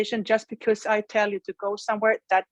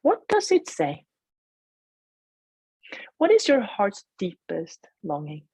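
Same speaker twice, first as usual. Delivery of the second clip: guided meditation. Acted. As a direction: gently guide them inward.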